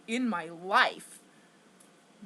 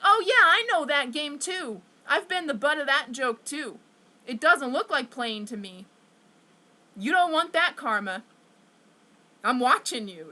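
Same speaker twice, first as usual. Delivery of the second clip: louder, pointed comeback; retold stern warning; 3 semitones higher, 6 dB louder than usual. Former bullied kid warns kids who claim they're playing, stern warning. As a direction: push back loudly on their excuse. Answers kids' excuse of just playing.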